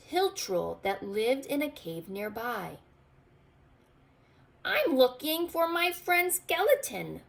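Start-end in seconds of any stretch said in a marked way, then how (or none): 4.7-7.2 high, eager little goblin voice. A narrator's reading. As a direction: pitch it up as the small goblin asking about his friend. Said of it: "Goblin told him".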